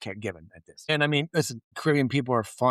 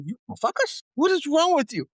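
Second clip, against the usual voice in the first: very high, incredulous jab; mocking disbelief; 4 dB louder than usual. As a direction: ridicule the idea. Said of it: "And the guy goes".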